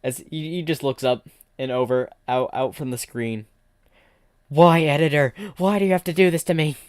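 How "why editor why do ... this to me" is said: loud, higher wail at the editor; mock despairing shout; anguished outcry. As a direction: cry out to the heavens, wronged. Kicked by kangaroo, complains to editor.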